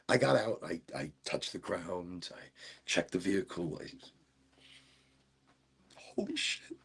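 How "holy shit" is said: breathless whispered "holy shit"; whispering in awe about the RV axle breaking on the mountain; awed whisper. Retold or imitated. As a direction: still shaken as you recall it. Recalling the broken axle scare.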